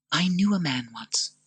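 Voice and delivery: earnest Lucilla. Acted. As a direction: urge him gently.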